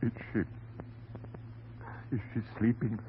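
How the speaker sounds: low, very soft, halting question; hesitant, hushed. Acted. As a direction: ask haltingly, almost afraid to know. Anxious husband asks after wife.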